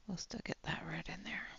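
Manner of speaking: soft whispered murmur to herself. Real, not acted. Painter murmuring to herself over a tricky stroke, whisper. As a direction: whisper it while concentrating. Painting quietly under her breath.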